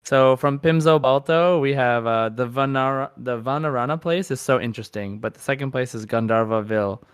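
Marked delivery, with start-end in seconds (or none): none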